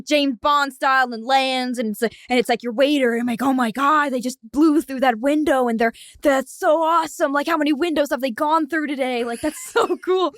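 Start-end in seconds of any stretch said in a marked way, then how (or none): 3.4-10.3 higher, gushing amazement; awestruck diner. Acted. Diner amazed by waiter's window crash, gushing. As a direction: gush like a thrilled customer.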